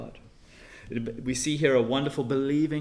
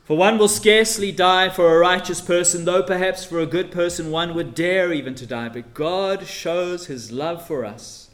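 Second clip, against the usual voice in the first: loud, higher pulpit delivery; preaching at full force; 4 semitones higher, 9 dB louder than usual. Acted. Preacher reading Romans aloud, loud preaching. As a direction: proclaim it to the congregation.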